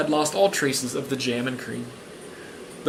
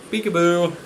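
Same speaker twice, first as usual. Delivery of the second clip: playful peekaboo; 2 semitones higher than usual. Pretend. Plays peekaboo with listeners.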